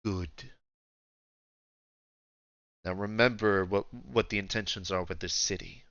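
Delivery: lightly voiced old ruler, quietly in command; understated deep voice of authority; refined old Emperor. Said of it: "very old, like, deep voice".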